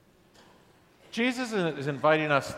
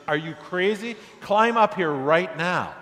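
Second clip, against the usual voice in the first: half-hearted retold yell over the bridge, a touch louder; 4 semitones higher, 4 dB louder than usual. A narrator's reading. Man on the bridge yells at the dangling man, mild mock yell. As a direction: call up to him in alarm, slowing on the order. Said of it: "he yells over the bridge".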